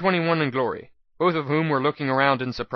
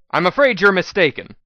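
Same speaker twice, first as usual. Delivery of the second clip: cool little smile; 5 semitones higher, 7 dB louder than usual. Acted. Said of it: "with a cool little smile".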